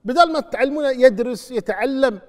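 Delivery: loud, high righteous rebuke; scolding sermon. Acted. Preacher scolding parents who help children cheat, furious. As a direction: berate the parents from the pulpit.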